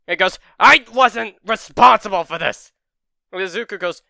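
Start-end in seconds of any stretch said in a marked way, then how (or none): 0.6-2.7 loud, high yelled denial; defensive shout. Acted. Bakugo yelling back at Izuku in denial. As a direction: shout him down, refusing blame.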